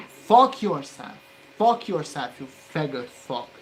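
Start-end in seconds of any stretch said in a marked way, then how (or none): 0.1-2.3 loud, higher and dragged out. Real, not acted. Curses the part loudly.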